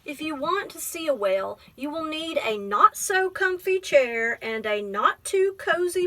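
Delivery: gentle, measured picture-book reading; teacher reading a poem book aloud to a child; reading to children. Acted. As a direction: read it like story time.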